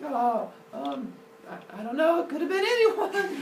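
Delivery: put-on high, hesitant guilty-kid voice; feigned innocence. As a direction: play innocent in a high little voice.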